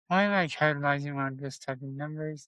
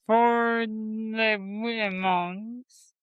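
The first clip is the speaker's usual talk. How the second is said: very slow, elongated, higher-pitched announcement; oddly drawn-out; 4 semitones higher, much slower than usual. Real, not acted. Co-host introducing the women's chamber line-up, oddly drawn-out. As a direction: stretch the words right out, pitched up. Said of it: "don't know why I said it like that".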